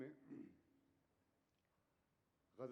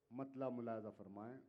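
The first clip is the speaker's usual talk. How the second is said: normal talking voice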